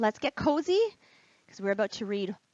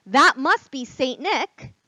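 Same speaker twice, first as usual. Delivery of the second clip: delighted realization; 4 semitones higher, 10 dB louder, slower than usual. Acted. Narrator's excited realization.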